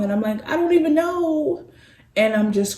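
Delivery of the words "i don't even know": high-pitched, mildly tearful retelling; retold tearfulness. Telling her sister she doesn't know why, mock tearful. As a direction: imitate answering through tears, voice lifted high. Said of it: "I just started crying".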